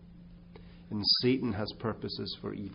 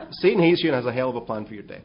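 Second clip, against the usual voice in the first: fast, louder, laughing through it; laughing repeat; 2 semitones higher, 9 dB louder, faster than usual. Real, not acted. Preacher repeating punchline to congregation, laughing. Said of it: "repeats the punchline".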